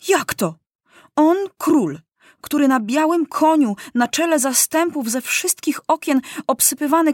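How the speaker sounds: high-pitched, wounded outrage; sustained high, aggrieved lament; choked-up indignation. A narrator's reading. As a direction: protest like a humiliated boy king near tears. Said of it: "Łzy go dusiły".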